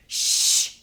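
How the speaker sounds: sharp, forceful shh; loud shush. Acted. Teacher to Bearing at rest time, loud shushing. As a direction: hush the child with a hard burst of air.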